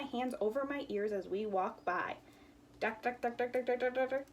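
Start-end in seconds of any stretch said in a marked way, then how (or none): 2.8-4.2 rapid pounding duck duck duck; mock jackhammer for kids; jackhammer noise. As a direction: rattle it out like a jackhammer. Jackhammer again.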